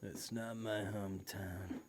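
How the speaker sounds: slow, low-key cowboy drawl; soft, drawn-out gunslinger delivery; Clint Eastwood. Retold or imitated. Teasing a co-host about his hometown, Clint Eastwood impression. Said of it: "sound like Clint Eastwood chewing on a cigarette".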